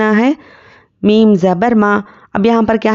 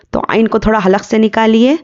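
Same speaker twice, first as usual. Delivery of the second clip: normal talking voice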